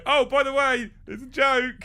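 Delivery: high, loud breezy taunt; mock cheery reveal. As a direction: pretend to yank the prize back.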